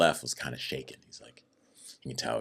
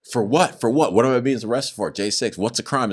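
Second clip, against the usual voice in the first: indignant demand; 6 semitones higher than usual. Retold or imitated. Demanding why he is arrested.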